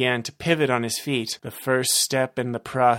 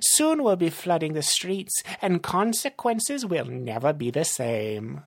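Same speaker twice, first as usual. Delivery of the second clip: raised-pitch villain voice; villain boasting of his scheme; scheming villain; 6 semitones higher than usual. Acted. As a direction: grandly announce the evil plan.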